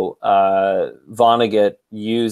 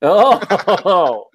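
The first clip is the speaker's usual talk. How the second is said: laughing exclamation; 8 semitones higher, 5 dB louder than usual. Real, not acted. Laughing at a joke.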